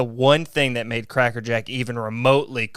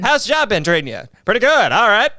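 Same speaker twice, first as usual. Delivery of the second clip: much higher and louder radio announcer greeting; old-timey radio voice; 8 semitones higher, 7 dB louder than usual. Pretend. Host imagining everyone greeting each other in old radio voice, much higher and louder. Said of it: "that's a fun voice to do".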